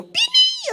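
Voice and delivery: very high, loud pim pim like a small bell; high bell sound. Retold or imitated. Preacher imitates the bell calling kids, high and bright. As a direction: ring it out like a tiny church bell.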